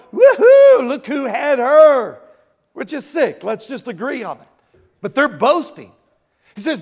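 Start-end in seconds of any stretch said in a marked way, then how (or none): 0.1-2.2 loud high whooping brag; boastful crowd. Retold or imitated. Corinthian church bragging about the sin, loud high mocking whoop. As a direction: crow about it like a gloating crowd. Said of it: "they're, like, going".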